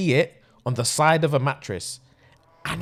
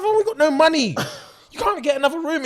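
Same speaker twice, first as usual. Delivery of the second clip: exasperated protest; 9 semitones higher, faster than usual. Retold or imitated. Acted argument with panicking friend.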